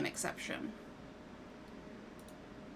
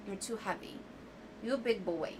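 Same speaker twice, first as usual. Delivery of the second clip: ordinary delivery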